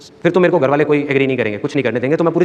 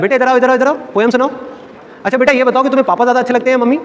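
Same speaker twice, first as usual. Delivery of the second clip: put-on higher-pitched voice for talking to children; mock indulgent auntie, pitched up; coaxing relative; 7 semitones higher than usual. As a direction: imitate an adult cajoling a little kid.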